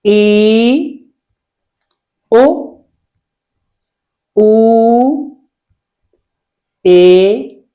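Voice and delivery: each vowel alone between long pauses, short ones short and long ones held; vowel sound drill. Shown as a demonstration. Teacher reviewing vowel sounds with students, isolated drill delivery. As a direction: recite the Hindi vowels one by one, mostly with a rising end. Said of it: "याद कर लेते हैं इसका sound".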